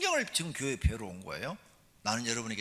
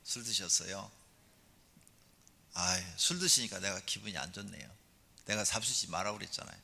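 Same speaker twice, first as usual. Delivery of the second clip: lower, gently voiced reproach; gentle wife; 4 semitones lower, 4 dB quieter than usual. Wife telling her drinking husband she is hurt, gentle and restrained. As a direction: say your hurt softly, without nagging. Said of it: "이 여자가 부드럽게 얘기하면".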